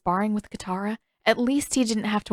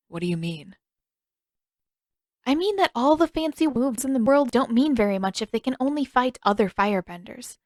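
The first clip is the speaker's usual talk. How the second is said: Katara, lightly voiced; 5 semitones higher than usual. Katara encouraging Zuko.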